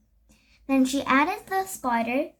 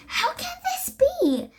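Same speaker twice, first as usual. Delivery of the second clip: much higher, astonished wonder. A narrator's reading. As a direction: marvel at it in disbelief. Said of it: "she wondered".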